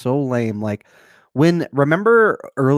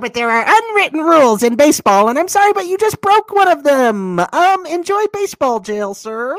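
high, loud, prim mock-official voice; mock scolding from a prissy baseball rule-keeper; prissy hall monitor; 11 semitones higher, 5 dB louder than usual. Mocking prissy umpire-like manager.

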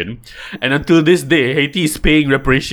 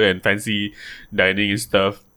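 ordinary talk

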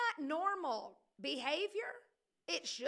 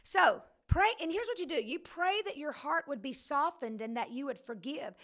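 ordinary delivery

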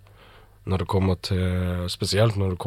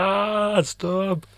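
put-on whiny kids; 12 semitones higher than usual. Kids whining at dad to stop, high. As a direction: whine like annoyed kids. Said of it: "Og ungene bare".

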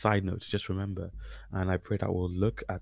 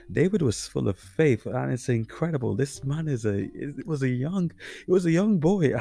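excited; 6 semitones higher, 6 dB louder than usual. Real, not acted. Marvelling at David's faith.

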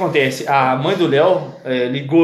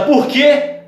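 mother asking; 10 semitones higher, 3 dB louder than usual. Retold or imitated. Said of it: "Aí ela".

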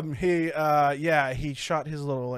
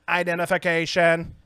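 stiff acted robot command; mock machine announcement; 3 dB louder than usual. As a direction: play a robot giving orders. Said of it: "the robot's like".

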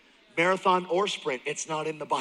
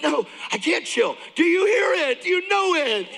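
much higher and louder, frantic excitement; can't chill; 13 semitones higher, 5 dB louder than usual. Retold or imitated. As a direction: refuse to calm down, climbing very high. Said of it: "I'm like".

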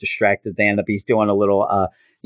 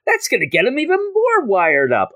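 higher-pitched jokey role-play; mock student banter; 7 semitones higher than usual. Teller joking about inviting Peter for Coke, high mock banter. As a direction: play out a silly objection, rising higher.

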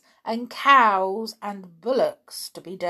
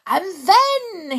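high, loud, drawn-out exclamation; carried-away cry; excited cry; 12 semitones higher, 9 dB louder than usual. A narrator's reading. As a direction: burst out with the next idea. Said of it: "he cried".

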